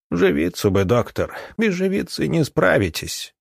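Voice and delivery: high-pitched parting admonition; old woman's warning. A narrator's reading.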